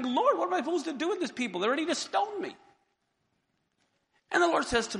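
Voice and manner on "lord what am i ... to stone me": higher, fast desperate plea; frantic plea to God; crying out. Acted. Moses crying out to the Lord about the people, fast higher plea. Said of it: "Moses cries out to the Lord".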